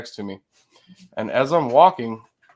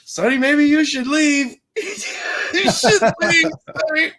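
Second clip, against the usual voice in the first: very high, louder, half-crying mock plea; imitating Cassie; 13 semitones higher, 7 dB louder than usual. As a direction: whimper it like a scared friend. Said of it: "it's Cassie going".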